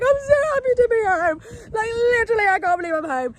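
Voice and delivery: high, loud sobbing relief; crying with joy. Retold or imitated. Seeing mum through the gates, crying with joy. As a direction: weep it out, overjoyed. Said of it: "It was like crying, tears".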